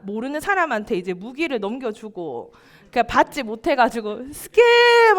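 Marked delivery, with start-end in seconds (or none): 4.3-5.2 high, loud yelled scam; child's shout. Retold or imitated. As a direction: shout it like an upset kid. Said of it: "하면서 이제 울었다고". (억울한 아이의 큰 외침)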